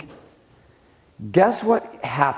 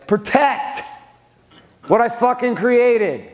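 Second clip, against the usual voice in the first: higher, slower, every word driven home; fierce emphasis; 9 semitones higher, slower than usual. Real, not acted. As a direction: punch it out like a rallying cry. Hammering home his point.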